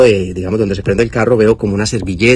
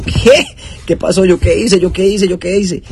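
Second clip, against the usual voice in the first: shocked disbelief; 9 semitones higher than usual. Retold or imitated. Told the police are at the door, he yelps in high shock. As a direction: yelp it like you just got woken by bad news.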